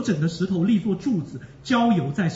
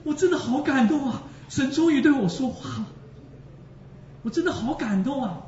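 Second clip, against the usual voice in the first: deeply moved; 5 semitones higher than usual. Real, not acted. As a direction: let the joy of being moved burst out.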